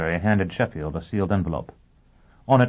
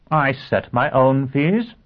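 slow, higher-pitched and emphatic; haughty assertion; 8 semitones higher, 6 dB louder, slower than usual. Acted. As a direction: state it slowly, putting the client in place. Lawyer asserts himself to client.

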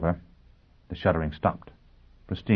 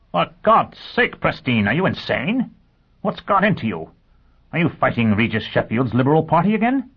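high, loud disbelieving cry; shocked, raised-pitch anger; incredulous outburst; 8 semitones higher, 4 dB louder than usual. Acted. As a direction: demand to know if he's lost his mind. Dagenham disbelieves Pristine's refusal.

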